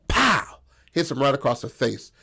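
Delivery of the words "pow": high, punched-out "pow"; sudden cartoon hit sound; imitated punch impact. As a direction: make the blow land with your voice.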